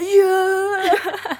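high cheer like a goal scored; cheering lads. Retold or imitated. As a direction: shout it like football fans celebrating. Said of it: "They're like".

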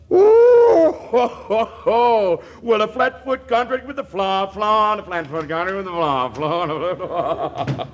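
high gloating ho ho ho; gleeful cackle. Acted. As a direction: cackle with glee over the flaw. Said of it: "you sure sound mighty happy".

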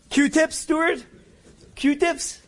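high, loud baffled cry; startled mishearing, pitched high; bewildered outburst. Retold or imitated. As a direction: yelp it out in confusion. Sudden confused outburst.

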